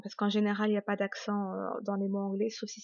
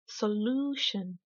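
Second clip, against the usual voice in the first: "solution" in English, LOO held long; slow teacher's "solution", weight on LOO; exaggerated English stress; 4 dB quieter, much slower than usual. Shown as a demonstration. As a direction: stretch LOO slowly in "solution", so-LOO-shun. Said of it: "je vais délibérément exagérer".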